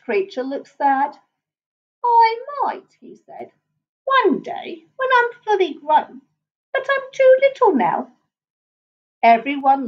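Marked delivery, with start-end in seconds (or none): 2.0-2.9 high-pitched small creature voice; small, high story-creature voice; little creature. A narrator's reading. Sad little creature answering the lion. 4.0-8.1 wistful little creature, pitched high. A narrator's reading. Sad little creature says it's too little.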